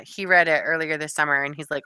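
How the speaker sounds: ordinary talk, nothing marked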